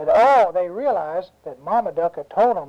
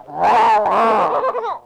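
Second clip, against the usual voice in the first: loud imitated bear roar; 3 semitones higher, 4 dB louder than usual. Storyteller plays the bear below the tree, roaring. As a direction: roar like a big old bear.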